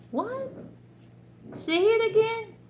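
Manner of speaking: high, mock confused voice; baffled listener asking for a repeat; mock puzzled husband. Husband asks her to repeat.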